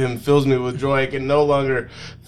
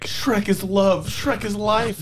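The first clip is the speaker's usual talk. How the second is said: higher, slowed mock devotion; lightly voiced, said softly as a joke; a hint of soft worship; mock tender; 8 semitones higher than usual. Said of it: "I say softly".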